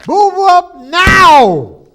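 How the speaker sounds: high, loud and very slow command; a final, drawn-out order to the computer; slow drawn-out yell. Acted. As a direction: stretch each word out in fury. Yelling at stuck computer.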